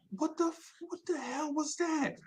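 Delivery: high, hushed amazement; breathless disbelief at what happened; stunned. Retold or imitated. Crew reacting to [name]'s verse, high and hushed.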